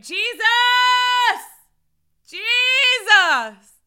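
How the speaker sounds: loud, high cry of Jesus; shout of praise. Shown as a demonstration. Pastor showing her shout of praise to viewers. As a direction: shout his name out loud. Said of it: "you have heard me say it sometimes".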